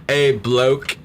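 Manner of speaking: slow, hammed-up Australian accent on "a bloke"; mock Australian drawl. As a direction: stretch out "a bloke" like a cartoon Aussie. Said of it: "that's Australian".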